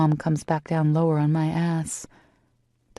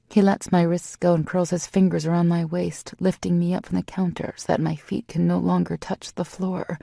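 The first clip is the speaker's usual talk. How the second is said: usual speaking voice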